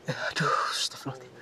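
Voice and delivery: whispered aduh and a prayer. Real, not acted. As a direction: breathe it out in worry. Man in distress.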